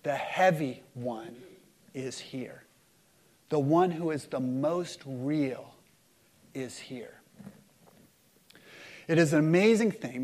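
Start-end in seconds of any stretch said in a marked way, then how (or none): 0.0-7.2 slow, low, falling almost to nothing; solemn, soft, with long pauses; hushed divine announcement. Acted. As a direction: let each phrase sink into a hush. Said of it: "And God says".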